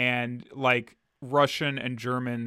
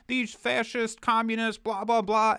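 high mocking put-on voice, pitched up; mocking politician; 9 semitones higher than usual. Mocking conservative politicians who call communists fascist, in a high put-on voice. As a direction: parody a blustering conservative politician. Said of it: "like, conservative politicians, like".

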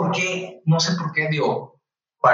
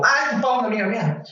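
lightly voiced young woman, higher; a casual, chirpy colleague; young doctor's voice; 8 semitones higher, faster than usual. A young colleague chatting to him about the patient, lightly voiced high.

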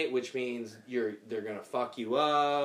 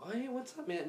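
high casual greeting; 9 semitones higher, 8 dB quieter than usual. Retold or imitated. Greeting the brother.

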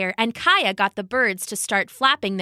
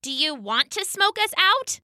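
snapping; 8 semitones higher than usual. Acted. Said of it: "snapped the cheetah".

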